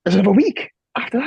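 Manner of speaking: high, fast disbelieving question; surprised. Real, not acted. Friend hearing a surprise announcement, surprised and disbelieving.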